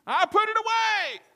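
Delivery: very high, loud falsetto; wife yelling back. Acted. Wife answering husband from another room, falsetto yell.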